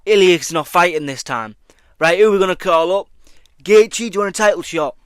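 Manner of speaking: a hint of a hurried matchmaker calling fighters; mock UFC matchmaker. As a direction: play the boss ringing round for a replacement. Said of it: "They're like".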